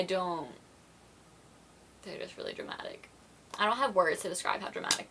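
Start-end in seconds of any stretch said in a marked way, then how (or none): 2.0-3.1 exasperated whisper. Real, not acted. Exasperated aside.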